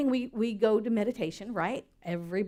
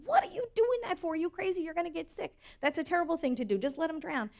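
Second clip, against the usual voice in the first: put-on much higher pitched, alarmed child; little boy; 7 semitones higher, faster than usual. Little boy protests to the old man, high and alarmed. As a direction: sound like a shocked little kid. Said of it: "And the little boy said".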